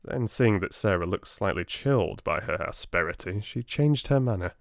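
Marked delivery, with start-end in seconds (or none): none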